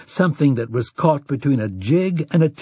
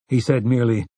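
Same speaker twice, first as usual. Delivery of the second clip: plain reading voice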